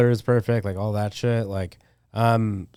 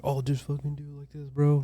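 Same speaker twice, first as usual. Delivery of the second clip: imitating a chill mentor, soft and higher; super mellow; 5 semitones higher, 6 dB quieter than usual. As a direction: softly toss it off like a laid-back dude. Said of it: "that's how he talks, like, super mellow".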